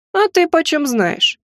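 выше и медленно, насмешливо; хмыкнуть снисходительно; с усмешкой. Acted. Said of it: "хмыкнул первый". (насмешливый вопрос свысока)

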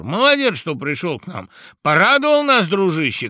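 high, loud bursts of welcome; tipsy guests shouting. A narrator's reading. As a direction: cry out delightedly to a friend. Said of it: "кричали подгулявшие гости".

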